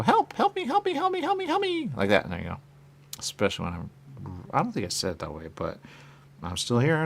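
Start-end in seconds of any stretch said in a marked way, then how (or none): 0.0-1.9 high-pitched pleading cry for help, repeated. Retold or imitated. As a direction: call for help in a much higher voice. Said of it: "I go".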